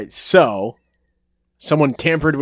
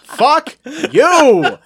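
laughing shout; 10 semitones higher, 6 dB louder than usual. Real, not acted. Laughing angry outburst at friend.

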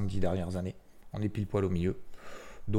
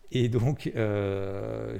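normal talking voice

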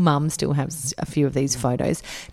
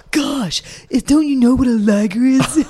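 high, loud exasperated nerd whine; Napoleon Dynamite; 8 semitones higher, 5 dB louder than usual. Retold or imitated. Imitating a movie nerd for a friend, high exasperated whine. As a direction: groan it out like an annoyed teen nerd who can't believe you don't know. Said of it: "when I saw Napoleon Dynamite and he draws".

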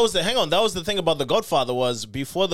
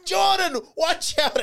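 high laughing yell of a warning; mom yelling; 13 semitones higher, slower than usual. Retold or imitated. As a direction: call out through laughter. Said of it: "And the mom's like".

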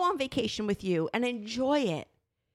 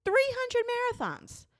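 emphatic exclamation; 13 semitones higher than usual. Real, not acted. Stressing her body's burden.

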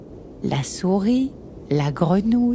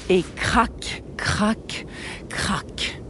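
cracks getting softer, far off; fading crack sound; 5 dB quieter, much slower than usual. A narrator's reading. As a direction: let the cracks fade away. Seam tearing sound effect.

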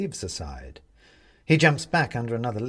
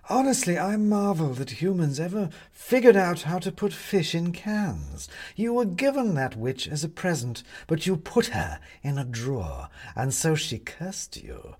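condescending cat; 7 semitones higher than usual. Acted. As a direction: talk down to the foolish human. Cat mocks Benedict.

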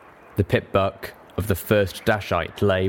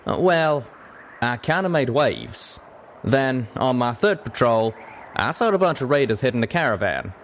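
higher-pitched folksy Western character; drawling cowpony; 5 semitones higher than usual. Acted. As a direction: tell your story as a plain-spoken cowpony.

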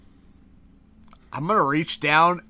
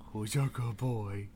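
soft, low cooing at a pet; baby talk to pet; 4 semitones lower, 11 dB quieter than usual. Acted. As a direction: coo lovingly at the dog. Cooing at the creature.

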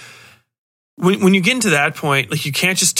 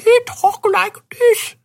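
put-on man-boy with weird speech impediment, pitched way up; slow, high, silly impeded speech; Hubie; slower than usual. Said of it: "a man boy with a weird speech impediment".

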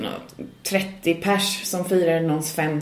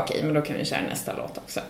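muttered aside; 4 semitones lower, 8 dB quieter, much faster than usual. Retold or imitated. Deadpan aside on stage.

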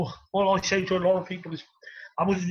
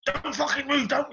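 a higher, snapped warning; mock manager yell; 8 semitones higher than usual. Footballer imitating a raging manager, higher mock yell. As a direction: bark it like the feared gaffer.

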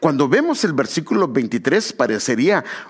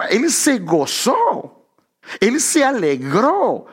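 exultant preaching; 7 semitones higher, much slower than usual. Acted. Preacher exults over Adam's joy.

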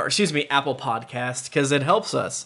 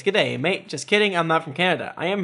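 ordinary talk

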